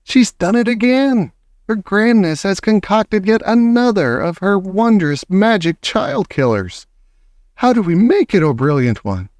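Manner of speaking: fawning witch audience; adoring witches. Acted. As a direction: gush over her genius. Adoring witches praise their leader.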